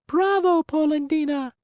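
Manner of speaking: very high tiny mocking cry; a tiny hidden voice calling out. A narrator's reading. Hidden voice from the wood mocks Geppetto, tiny and high. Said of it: "cried the same tiny voice".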